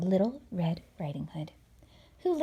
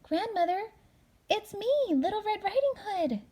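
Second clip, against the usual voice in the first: put-on much higher girl's call; 12 semitones higher than usual. Girl at the door calling grandmother, high girl's voice. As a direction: call out brightly like a little girl. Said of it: "called out".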